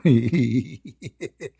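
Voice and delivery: big open laugh, real; hearty laugh. Real, not acted. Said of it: "laughing so hard I can't even breathe".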